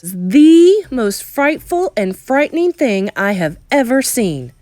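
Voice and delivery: put-on boy recalling the witch's face; dread. A narrator's reading. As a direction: linger on the fright. Narrator describing frightening face.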